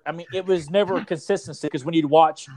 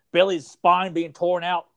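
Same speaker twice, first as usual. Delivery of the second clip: ordinary talk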